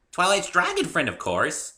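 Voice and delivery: high, loud bouncy pony voice; bubbly pony. A narrator's reading. Pinkie explaining Spike to the newcomer, high and bubbly. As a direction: chirp it like a cheerful pony.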